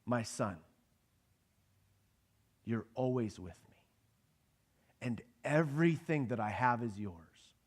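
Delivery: soft, gentle fatherly reassurance; a father gently reassuring his resentful older son; tender father. Acted. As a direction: hush down and reassure him like a loving father. God's tender answer to son.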